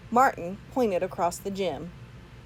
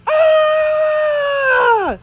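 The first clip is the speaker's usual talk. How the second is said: very high, very loud scream; terrified scream; 12 dB louder than usual. Acted. Bully seeing the head blink, loud high scream. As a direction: scream in sudden fright. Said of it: "yelled the bully".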